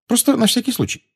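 flustered, high-pitched addition; agitated. A narrator's reading. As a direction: add it nervously, voice pitched high. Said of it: "взволнованно сказала Гермиона". (взволнованно, высоким голосом)

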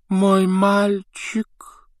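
high, very drawn-out lament; sighing. A narrator's reading. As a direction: sigh it slowly with doomed pity. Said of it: "вздохнула профессор". (со вздохом, протяжно)

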